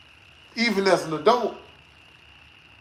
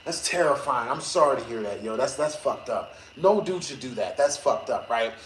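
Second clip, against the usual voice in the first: lower, softer sympathetic voice; mock sympathy; 7 semitones lower, 5 dB quieter than usual. Retelling comforting girlfriend, low soft sympathy. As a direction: console her gently, low and soft. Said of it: "I was like".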